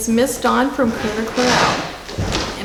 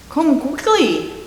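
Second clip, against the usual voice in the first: put-on urgent call in a Scottish accent, pitched higher; a Scottish constable calling for help; 6 semitones higher than usual. Constable summons the detectives, Scottish accent. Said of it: "the Scottish carrot constable".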